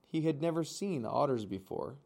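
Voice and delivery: ordinary delivery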